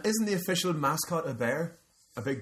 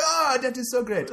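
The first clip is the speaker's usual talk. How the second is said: bad Ethiopian accent; 10 semitones higher than usual. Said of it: "That's not even an Ethiopian accent".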